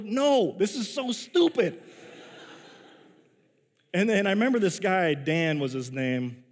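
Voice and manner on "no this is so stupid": higher-pitched, indignant refusal with some laughter; mock exasperated protest. Camper refusing the counselor's silly order, exasperated protest. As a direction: push back, half laughing in disbelief.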